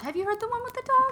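high, fast squeaky question; mock high voice. Teasing friend mimics the question in a high squeak. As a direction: pitch it way up and rattle it off fast.

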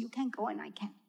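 put-on hushed little kid's whisper; soft unvoiced secret. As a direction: breathe it out without voice. Small kid quoted.

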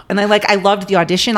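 normal talking voice

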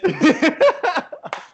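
high, loud burst of laughter; helpless high-pitched laugh. Real, not acted. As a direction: crack up at your own punchline. Cracks up at own punchline.

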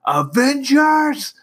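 high, slow hammed-up rallying cry; booming movie call. Captain America rallying the Avengers, a slow hammed-up shout. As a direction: belt it out like a hero rallying troops. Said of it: "he doesn't yell it".

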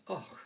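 a quick Scottish "Och" as a pause filler. Shown as a demonstration. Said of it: "she says".

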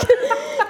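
short high burst of laughter. Real, not acted. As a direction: laugh at the memory. Laughs at parents.

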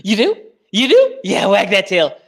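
high excited pet voice; talking to a dog. Pretend. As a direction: coo at the dog.

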